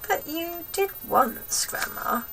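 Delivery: whispered and slow; a small boy daring to whisper back; timid whisper. Acted. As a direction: breathe it out softly, afraid to argue. George voiced in story.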